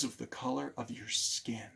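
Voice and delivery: hushed whisper on the injustice. Real, not acted. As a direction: drop to a breathy hush as if sharing something shocking. Telling of an unjust marriage ban.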